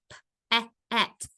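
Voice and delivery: pet split into p-, e-, et; segmented phonics demo of p-, e-, et; sounded out. Shown as a demonstration. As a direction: isolate p-, repeat short e-, then et with gaps. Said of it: "same vowel sound as in".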